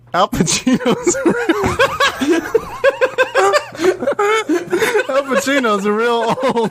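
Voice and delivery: high, slow, breaking into laughter mid-line; laughing imitation of wife. Husband retelling wife watching trailer, laughing high voice. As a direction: giggle through it while mimicking her. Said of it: "she goes".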